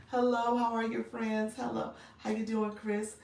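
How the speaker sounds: ordinary delivery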